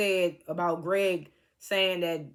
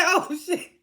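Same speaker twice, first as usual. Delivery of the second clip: high, laughing shock; laughing exclaim; 9 semitones higher than usual. Real, not acted. Vlogger laughing at the shade on the show, high laughing exclaim. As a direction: blurt it out through laughter.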